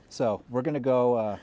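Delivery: usual speaking voice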